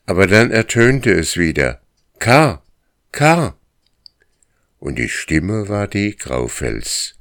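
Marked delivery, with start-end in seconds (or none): 2.2-3.6 loud, high call through the forest; an eerie loud call of his friend's name; strange calling voice. Acted. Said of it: "einen Klang hatte, wie ihn der Hund nie zuvor gehört".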